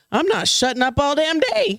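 high, gleeful boast of nonstop talking; mock delighted declaration. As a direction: announce it big and high, relishing the idea. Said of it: "woke up with an English accent, I'd be like".

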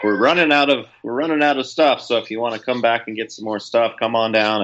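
train bartender announcement. Retold or imitated. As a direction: hype up the bar car like a chatty train attendant. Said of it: "came on a couple times to say".

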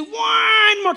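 put-on high, loud childish whine; whiny kid. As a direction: beg like a kid at bedtime. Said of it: "Your kids do it".

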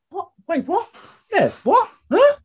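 mock confusion. Mocking himself sat there baffled on the podcast, high-pitched confusion. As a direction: play the lost podcaster who can't keep up. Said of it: "it's just me sat here going".

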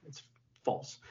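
breathy unvoiced whisper; whispered aside. Real, not acted. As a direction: drop to a secret whisper. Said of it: "aside, whispered".

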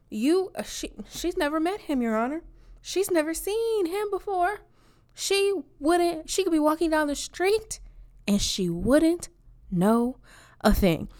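high, put-on witness voice swearing to a judge; mock witness. Testifying to Your Honor as a hammed-up witness. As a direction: play the earnest witness on the stand.

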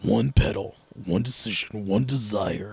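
low, softening to a whisper; hushed, portentous slogan, parody style; mock trailer voice.

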